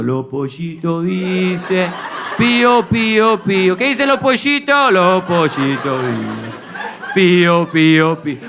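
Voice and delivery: drawn-out, hammed-up Argentinian recitation; Argentinian accent. Shown as a demonstration. Father reading to baby, hammed-up Argentinian accent. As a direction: stretch the nursery rhyme in Argentinian Spanish. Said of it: "en argentino".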